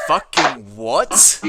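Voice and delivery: loud incredulous cry; startled outburst. Acted. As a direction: blurt it out as if jolted awake. Player acts character jerking awake.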